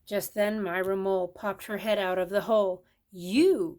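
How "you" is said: loud accusing cry; cried out. A narrator's reading. Squirrel accusing the mole, loud cry. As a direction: hurl the word at her. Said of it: "cried Burl".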